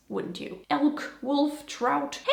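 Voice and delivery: ordinary talk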